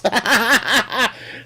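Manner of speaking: high, rattling laugh; real laugh. Real, not acted. Streamer joking about those damn Scots, high laugh. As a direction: crack up at your own joke.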